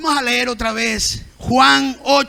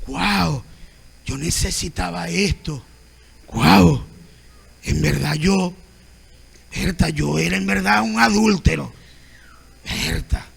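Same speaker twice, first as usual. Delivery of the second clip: low, hushed, awed voice of a humble listener; a touched churchgoer, gently acted; humble believer moved by the word; 6 semitones lower than usual. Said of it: "el orgulloso no dice".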